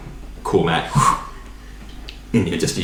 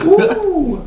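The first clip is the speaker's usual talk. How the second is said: short, very high, louder woo; high whoop; 5 dB louder than usual. Real, not acted. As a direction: let out a high falsetto whoop. Game master's wordless reaction.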